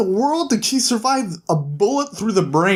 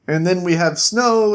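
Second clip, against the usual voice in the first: ordinary delivery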